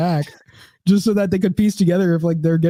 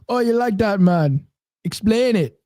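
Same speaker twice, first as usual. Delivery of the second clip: put-on Jamaican accent; 5 semitones higher, slower than usual. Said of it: "these Jamaican people".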